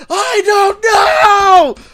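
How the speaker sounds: high, drawn-out mock scream; hammed-up panicked shriek; freaking out. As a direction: wail it loud and slow, imitating a kid's meltdown. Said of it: "Barney started freaking out and goes".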